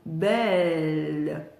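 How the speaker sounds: "Belle" low and slow with a long L; lowering the tongue. Shown as a demonstration. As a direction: ease "Belle" down into a held L. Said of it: "je baisse ma langue".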